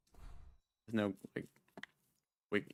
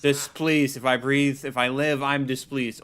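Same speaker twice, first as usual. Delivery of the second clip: loud, offended proclamation; indignant character voice; 4 semitones higher, 7 dB louder than usual. Acted. Game character rebuking an unwelcome visitor, indignant. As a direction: declaim it with haughty displeasure.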